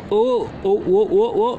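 ordinary talk, nothing marked

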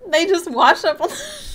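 high, loud, collapsing into laughter; real giggles swallow the punchline; laughing. Real, not acted. Streamer failing to finish the punchline, laughing. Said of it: "I don't know why I'm laughing".

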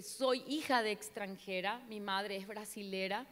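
ordinary talk, nothing marked

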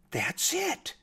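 sudden high-pitched, hushed eureka; light up with a new idea, very high; excited realisation. A narrator's reading. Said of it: "The bat got so excited".

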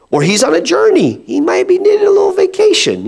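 high, sing-song mockery of their god; mocking taunt. Elijah mocking the prophets of Baal, high sing-song. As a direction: taunt them like Elijah would. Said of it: "Elijah began to mock them".

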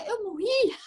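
high, anxious disbelief; frightened patient asking the nurse; panicked question. Retold or imitated. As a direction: ask it as if truly afraid. Desperate, calling with the bell.

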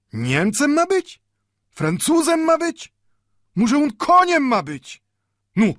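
put-on very high, loud, indignant old man; fiery indignation. A narrator's reading. Old bookbinder throws the slur back at the watchmaker, indignant. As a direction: fire off outraged questions, pitch soaring. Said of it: "Nowe iskry rozgorzały w oczach starego introligatora".

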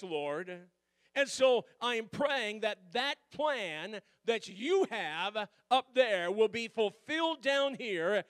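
loud, rising preaching with drawn-out prayer lines; fervent preaching. Acted. Pastor preaching to his church about praying for God's will, fervent and loud. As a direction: preach it home with passion.

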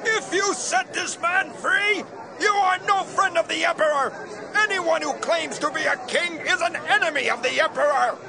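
high-pitched mob shouting; high, insistent group cry; crowd yell. Acted. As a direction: yell a warning as a hostile crowd.

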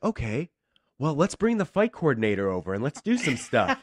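high, loud, eager suggestion; imitated upbeat auditioners. Casting people calling in fight coordinator, mimicked as eager and high. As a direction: mimic chirpy enthusiasm, pitched well up.

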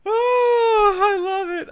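very high, loud "oh"; overjoyed cry. Real, not acted. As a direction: squeal with delight. Overjoyed, says he will cry.

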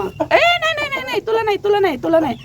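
high-pitched fending off. Real, not acted. Host backing off from her rooster, squealing no.

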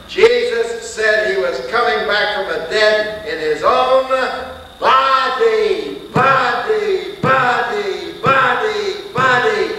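high, loud preaching, body pounded again and again; a preacher driving the point home at full voice; hammering shout. Acted.

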